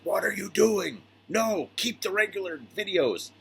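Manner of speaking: high whiny viewer voice; mock complaining viewer. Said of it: "everybody's like".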